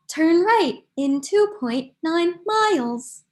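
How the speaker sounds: slow, measured navigation prompt; imitating an automated direction; phone GPS voice. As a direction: cut in like a GPS giving directions. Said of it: "dependent on my phone G P S".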